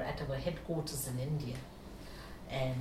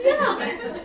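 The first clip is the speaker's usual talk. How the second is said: high, loud yes through laughter; laughing yeah; 11 dB louder than usual. Real, not acted. Confirming the mantra is food, laughing loudly. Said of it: "I said".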